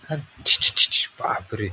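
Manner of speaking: breathy whispered yuck, playing along; mock disgust. Parent to toddler over the toy, whispered mock disgust. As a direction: hush it out like the toy stinks.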